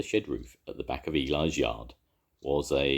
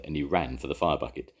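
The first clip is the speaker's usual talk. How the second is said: ordinary delivery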